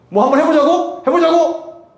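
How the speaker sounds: high, rising aggressive challenge; picking a fight. Retold or imitated. Lifeguard jabs preacher's neck, high goading challenge. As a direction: imitate a cocky man squaring up.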